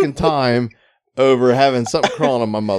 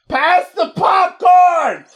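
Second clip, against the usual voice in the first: very slow, high shout; mock yell; 4 dB louder, much slower than usual. Yeller at the movies asking for popcorn, slow shout. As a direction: drag it out in a loud high yell. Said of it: "try to whisper something".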